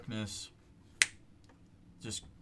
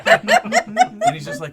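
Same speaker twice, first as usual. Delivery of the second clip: loud laughing, much higher; can barely talk for laughing; laughing hard; 20 dB louder, much slower than usual. Real, not acted. GM cracking up at the player, laughing.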